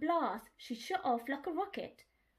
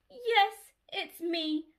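wailing; 6 semitones higher, 5 dB louder, much slower than usual. Acted. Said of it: "wailed Dolly".

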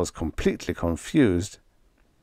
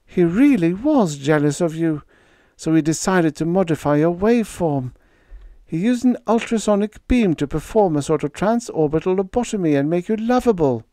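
higher, tremulous telling; 9 semitones higher than usual. A narrator's reading. Panda tremulously explaining the doctor's scheme to Freddie. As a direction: tell it with a trembling voice. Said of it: "in a tremulous voice".

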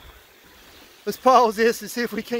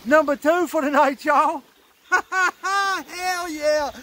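excited; 10 semitones higher, much slower than usual. Real, not acted. Celebrating his second catch.

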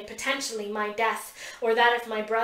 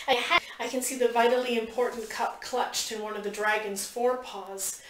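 ordinary delivery, nothing marked

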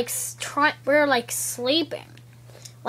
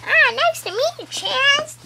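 much higher, cartoonish greeting; squeaky toy voice; 12 semitones higher, 4 dB louder than usual. Little toy greeting old Chaz, in a squeaky voice. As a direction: greet him in a tiny squeaky voice.

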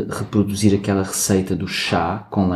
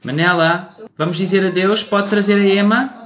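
high, raised voice calling someone over; 10 semitones higher than usual. Real, not acted. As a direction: call across the house with a lifted, questioning end. Calling Manela from another room. (a chamar alguém em voz alta)

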